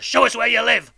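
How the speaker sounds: put-on bully the child into answering; menacing convict. A narrator's reading. Convict asks boy where he lives, menacing.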